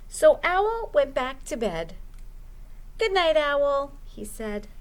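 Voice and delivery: lively storytime narration; reading to children. Acted. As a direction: read it like a children's librarian at storytime. Librarian reads picture book aloud.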